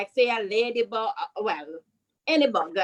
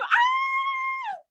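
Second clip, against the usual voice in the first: short, very high playful scream; mock scream. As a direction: squeal "Ah!" like a kid fleeing a bug. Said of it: "run and scream".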